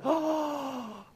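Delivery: mock kid's gasp, high; surprised gasp. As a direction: gasp then a high little oh. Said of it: "they're like".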